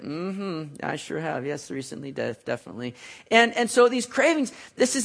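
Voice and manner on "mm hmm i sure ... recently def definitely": soft mock reply. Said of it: "And Natasha's like".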